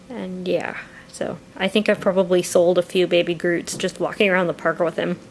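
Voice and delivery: ordinary talk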